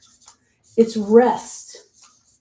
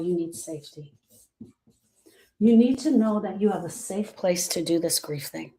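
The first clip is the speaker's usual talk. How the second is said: tender; 4 semitones lower, 3 dB quieter than usual. Real, not acted. Soft reassurance.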